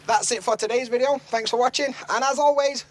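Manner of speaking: high, upbeat presenter's outro; vlog sign-off. Acted. Vlogger closing the video for viewers, high punchy sign-off.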